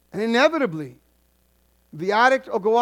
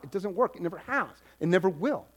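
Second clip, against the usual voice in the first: quiet emphasis; 6 semitones lower, 7 dB quieter than usual. Shown as a demonstration. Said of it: "You get that?".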